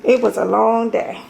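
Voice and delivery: usual speaking voice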